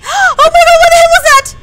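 high, loud, panicked demands; gasp, then cry out in alarm over and over; frightened shouting. Real, not acted. Frightened by a jump in game.

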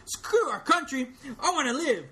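high, loud panicked yell; Mansley screaming to save himself; cowardly scream. Retold or imitated. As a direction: shriek it like a coward. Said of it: "read it in character of all the".

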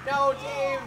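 loud, high cry of dismay; dismayed shout. Real, not acted. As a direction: yell as your buddy falls. Marine Dave dies in fight.

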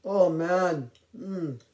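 talking while chewing; words muffled by food; mouth full. Real, not acted. Seen 0: Bit donut at -5; cheeks puffed, chewing while speaking.

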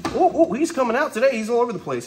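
high eager voice; rising thrill as he moves; excited. Real, not acted. Snake suddenly comes out.

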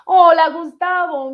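loud, high, stretched-out hello; excited greeting. Real, not acted. Streamer spotting Gustavo in the live chat, excited greeting. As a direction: call out a big, slow hello. Greets a viewer who joined.

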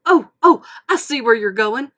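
loud eager burst; excited. Acted. Mater catching Lightning's idea, loud. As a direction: jump in thrilled as it clicks. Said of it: "Mater bounced up and down".

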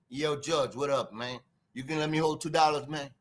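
put-on easy New York accent, asking to borrow. Showing how New York talks, a low New York accent. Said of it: "how New York talk?".

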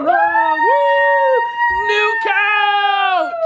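high, loud woo of joy; thrilled new-couch cheer; excited cheer. Real, not acted.

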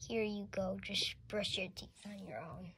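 sneaky whispered aside; whispering. Pretend. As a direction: whisper it so nobody hears. Sneaky dentist mutters aside.

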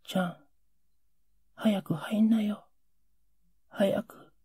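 urgent whisper to father. Retold or imitated. Son whispering to his father in the dark to come in. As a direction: hiss him inside, hurry, keep quiet. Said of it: "俺は低い声で呼ぶんだ。".

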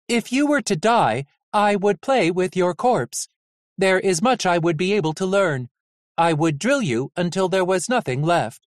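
flat mechanical robot voice. A narrator's reading. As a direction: deliver it like a cold machine. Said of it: "In a flat, mechanical voice".

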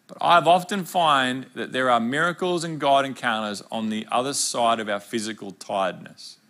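normal talking voice

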